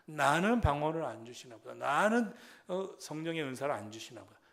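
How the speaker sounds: loud, stretched stress on 나는; complaining. Retold or imitated. Believer feeling left out, complaining with heavy 나는. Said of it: "어떤 사람은 그래요".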